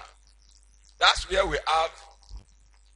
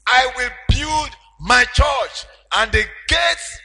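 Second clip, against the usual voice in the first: loud, higher preaching surge; preaching at full force; 5 semitones higher, 7 dB louder than usual. Acted. Preacher telling congregation of Peter, in full preaching cry. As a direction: lift the church with it. Preaching climax over music.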